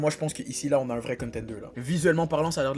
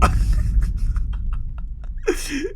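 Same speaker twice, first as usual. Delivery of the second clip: wheezy laugh; 12 semitones higher, 4 dB louder than usual. Real, not acted. Laughs after food joke.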